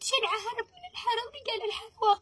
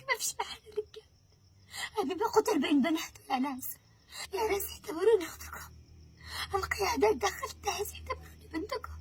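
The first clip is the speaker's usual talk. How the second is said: ordinary talk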